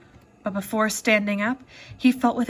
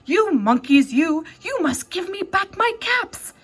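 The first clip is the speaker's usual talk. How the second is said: peddler shouting, voice pitched up; shouted; 7 semitones higher than usual. Acted. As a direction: shout at the monkeys in full anger.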